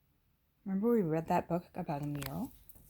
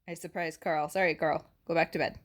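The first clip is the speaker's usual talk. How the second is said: ordinary delivery, nothing marked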